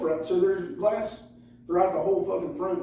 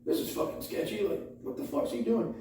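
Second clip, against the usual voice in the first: hushed aside; 2 semitones higher, 5 dB quieter than usual. Retold or imitated. Speaker in the car reacting to a stranger, hushed. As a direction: keep it under your breath, wary. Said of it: "and I'm like".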